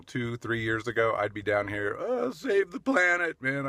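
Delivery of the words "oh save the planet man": high, slow, airy mock of an earnest environmentalist; mocking his old eco self. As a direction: parody the save-the-planet crowd, high and drawn out. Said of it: "I used to be these people".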